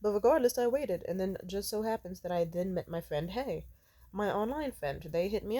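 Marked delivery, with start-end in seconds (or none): none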